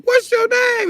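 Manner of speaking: high, loud interrogating cop; cop demanding name. Retold or imitated. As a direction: bark the question at the suspect. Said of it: "They be like".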